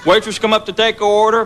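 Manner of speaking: usual speaking voice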